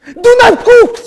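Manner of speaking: high, loud angry scolding; furious scolding. Retold or imitated. Rabbi voices a parent yelling at a sulking son, high and loud. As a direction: blast him with anger over the attitude.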